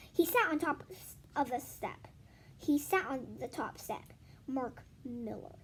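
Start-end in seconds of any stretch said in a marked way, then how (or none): 4.5-5.6 slow whispered Mark Miller; whispered name. A narrator's reading. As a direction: try the name softly, slowly. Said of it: "he said out loud".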